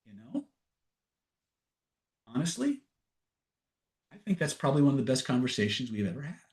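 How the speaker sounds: soft, hesitant admission, acted out; quietly sincere. Girlfriend judging the conversation they just had, softly sincere. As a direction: imitate a thoughtful, hushed confession with pauses.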